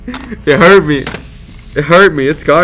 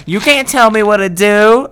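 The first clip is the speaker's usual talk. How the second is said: higher, drawn-out mock retort; woman talking back; 4 semitones higher, slower than usual. Imitating the woman answering the neighbor, put-on retort. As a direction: stretch it out in a put-on voice. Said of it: "And she's like".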